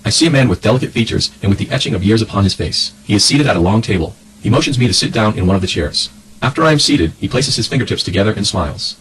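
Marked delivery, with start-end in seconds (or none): none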